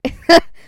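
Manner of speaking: sudden high laugh; burst of laughter. Real, not acted. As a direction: crack up laughing. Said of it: "Sorry, it's just really funny".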